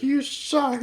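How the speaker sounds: very high-pitched mock scream, no real volume; imitated scream. Film character screaming at his friend from the bus, mock yell. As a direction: imitate someone yelling from a bus. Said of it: "he's screaming at him from the bus".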